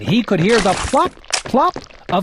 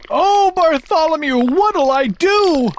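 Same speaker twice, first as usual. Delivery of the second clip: wailing plea; 8 semitones higher than usual. Acted. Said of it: "the trumpeter wailed".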